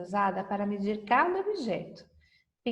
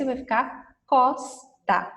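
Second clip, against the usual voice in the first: slow and clear; 2 semitones higher, 4 dB louder, slower than usual. Shown as a demonstration. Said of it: "Ou o S".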